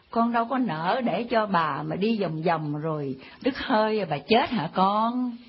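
low, wheedling grandmotherly sweet talk; sweet coaxing. Acted. Old woman coaxes boy for directions.

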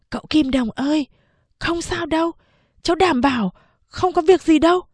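put-on high, bright child voice promising it's safe; a boy talking his master out of fear; reassuring boy servant. Servant boy assuring master the jump is safe, high and eager.

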